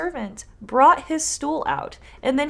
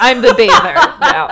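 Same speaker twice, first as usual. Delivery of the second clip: 1 laughing bather voice; 7 semitones higher, 12 dB louder than usual. Acted. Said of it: "My voice is hoarse".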